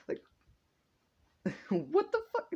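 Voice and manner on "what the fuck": very high, squeaky disbelief; comic high-pitched astonishment; mock shock. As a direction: shoot way up in hammed-up bewilderment. Acted shocked reaction of onlookers.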